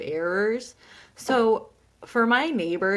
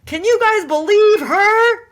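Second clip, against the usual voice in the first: high, loud, drawn-out appeal to a crowd; hammed-up incredulous stand-up delivery; comedy routine; 13 semitones higher, 10 dB louder, slower than usual. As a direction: play it big to an audience. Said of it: "like, a comedy routine".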